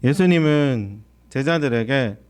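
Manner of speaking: normal talking voice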